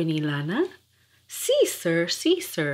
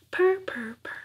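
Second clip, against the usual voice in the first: soft whispered purr; 10 semitones higher, 4 dB quieter than usual. Acted. Kittens pleased with their pie, whispered purring.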